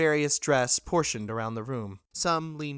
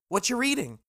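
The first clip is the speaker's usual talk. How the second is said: sudden question; 10 semitones higher than usual. Acted. Motley startles the narrator.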